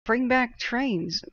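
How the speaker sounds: a passionate, drawn-out demand for trains; emotional plea. Real, not acted. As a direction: drag out each word of Bring back trains, hitting back. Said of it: "I'm emotional about that".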